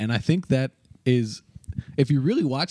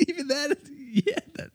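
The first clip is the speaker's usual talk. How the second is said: high, giggly laughing words; 5 dB quieter than usual. Real, not acted. Laughing at weasel laugh memory.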